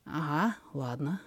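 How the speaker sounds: roughened, breathy give-in; hoarse. A narrator's reading. Danny to Steve, aroused and yielding, hoarse and soft. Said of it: "хрипло говорит Дэнни".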